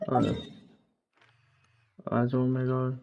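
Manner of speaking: ordinary talk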